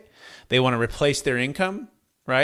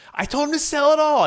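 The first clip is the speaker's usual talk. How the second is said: retold told-you-so, voice leaping up; mock-insistent spouse, much higher; exasperated insistence; 13 semitones higher, 3 dB louder than usual. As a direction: imitate an exasperated wife, high-pitched. Wife recounts the argument, retold.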